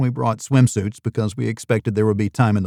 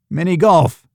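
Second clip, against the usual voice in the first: high, loud sudden blurt of mini golf; 7 semitones higher, 5 dB louder than usual. Acted. Ian blurts out a joke to the host, high and loud. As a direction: let it burst out before you can stop it. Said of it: "I blurted".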